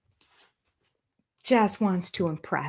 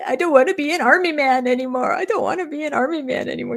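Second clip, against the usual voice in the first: higher-pitched mock scared protest; Nick; 8 semitones higher, faster than usual. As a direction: imitate a frightened recruit's high plea. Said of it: "he was scared of everything".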